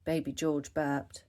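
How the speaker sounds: usual speaking voice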